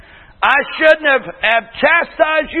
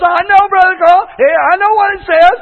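higher, louder insistent retort; exasperated wife; 5 semitones higher, 4 dB louder than usual. Retold or imitated. Wife arguing with Brother Carl, exasperated and insistent. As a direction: wave off the reminder impatiently, mimicking her. Wife brushes off pastor's question.

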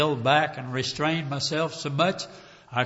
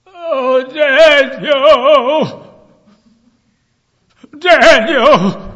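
loud, high wailing call; pained, lamenting cry; 12 semitones higher, 14 dB louder, much slower than usual. Acted. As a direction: cry out in anguish across a distance. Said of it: "A pained voice".

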